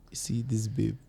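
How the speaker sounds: low, hushed aside to a confidante; quiet, half-whispered gossip; confiding gossip. Acted. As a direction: share it under your breath. Confiding gossip to his mom.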